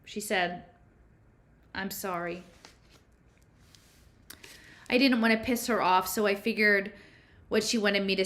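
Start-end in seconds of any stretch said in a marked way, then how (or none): 1.7-2.4 softer, hushed second apology; quiet apology. A narrator's reading. Violet repeats her apology to the narrator, quietly. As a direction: say it again, barely above a breath of sound.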